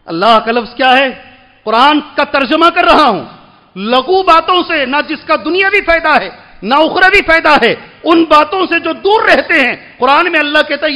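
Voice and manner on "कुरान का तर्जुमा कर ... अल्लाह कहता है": raised, emphatic sermon voice; forceful preaching. Real, not acted. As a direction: drive the point home from the pulpit.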